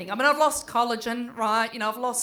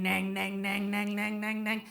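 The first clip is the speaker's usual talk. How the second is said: mock ringing sound; 2 semitones lower, 4 dB quieter than usual. Shown as a demonstration. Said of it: "in your ears it goes nang".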